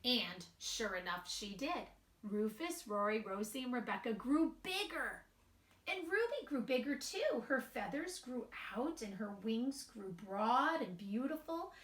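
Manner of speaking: slow, sing-song storybook reading; gentle storybook narration with light character voices; reading to children. A narrator's reading. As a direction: read it aloud to a group of little kids. Reads picture book to children.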